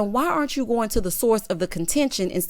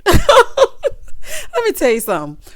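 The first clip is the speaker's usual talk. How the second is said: slow, loud, high laughing through the words; 8 dB louder, much slower than usual. Real, not acted. Host cracking up at Daryl's remark, slow laughing words. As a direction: laugh it out before the point.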